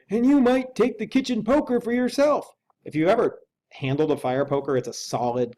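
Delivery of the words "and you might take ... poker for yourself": higher, louder anxious servant voice; a nervous old butler urging action; Poole the butler. Acted. Butler urges the lawyer to arm himself, anxious and high. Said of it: "continued Poole".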